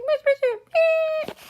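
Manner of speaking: very high, loud, drawn-out squeal; wailing shriek. Acted. Merchant whose spaceship is stolen, long squealed wail. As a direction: shriek after the stolen ship, slowly.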